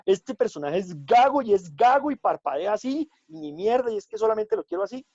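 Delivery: imitating an inflexible director laying down the law; bossy director. Actor imitating a rigid director giving orders, mock stubborn. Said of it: "si el director va llevado a su parecer y decir".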